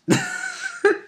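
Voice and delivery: a shrill scheming laugh; high evil cackle. Acted. Character gloating over the bribe, with a high cackle. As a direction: cackle high, pleased with the trick.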